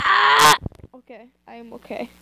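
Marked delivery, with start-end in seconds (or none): 0.0-0.6 loud high yelp; startled yelp. Real, not acted. Reader startled by a noise, yelping. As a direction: jump at the noise.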